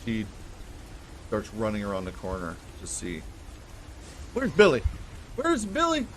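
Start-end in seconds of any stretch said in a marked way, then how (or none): 4.3-6.1 high-pitched acted yell. Mary yelling after the runaway, high urgent call. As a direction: yell after him, climbing higher. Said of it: "And she's yelling".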